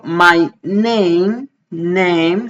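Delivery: "name" said "neym", A as "ay"; diphthong A. Shown as a demonstration. Teacher modelling "name" with its A said "ay". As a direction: say "name" with the English letter sound "ay". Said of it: "ahí sí la pronuncio A".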